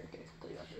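secret whisper. Real, not acted. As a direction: hush it to yourself. Same words whispered after.

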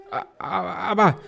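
stammering. Acted. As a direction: stumble over the words, caught out. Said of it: "stammelte Tom".

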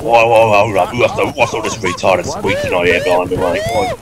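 lightly voiced Australian bloke rallying his mates; easygoing Australian accent, mate-heavy; a touch of Aussie accent for a cheeky squad leader; Australian officer. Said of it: "it's Australian Ted".

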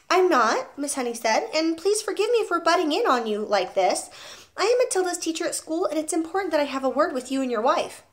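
a gentle, earnest teacher voice; Miss Honey. A narrator's reading. Teacher apologizing to the father for calling, polite.

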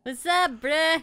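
dude voice. Acted. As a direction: greet like a chill bro. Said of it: "the voice I gave for this".

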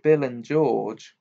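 ordinary delivery

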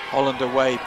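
normal talking voice

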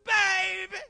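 high mock cry of "Baby"; imitating church lady. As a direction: call everyone baby, sing-song. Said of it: "She called everybody baby".

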